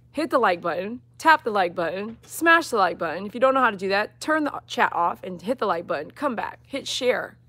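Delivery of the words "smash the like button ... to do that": ordinary delivery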